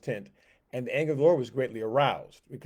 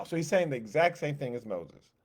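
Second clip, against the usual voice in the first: ordinary talk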